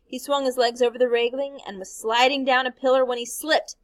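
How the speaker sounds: ordinary delivery, nothing marked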